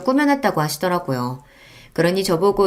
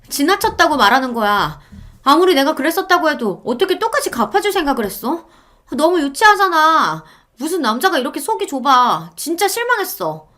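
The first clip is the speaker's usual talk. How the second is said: higher reproachful wife's voice; upset wife; 8 semitones higher than usual. Acted. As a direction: scold him like a disappointed wife. Wife scolds husband. (실망한 아내의 높은 목소리)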